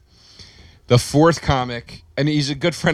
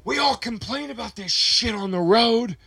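much higher, hammed-up drunken tirade; ranting at friends, pitched way up; mock drunken rant; 6 semitones higher, 3 dB quieter than usual. Drunk comic calls out friends.

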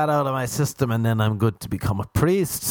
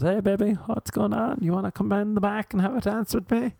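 higher, coaxing come-on voice; stripper come-on; 10 semitones higher than usual. Retold or imitated. Stripper coaxing a customer into a private dance. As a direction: lure him to the back room. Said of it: "like the women at strip clubs".

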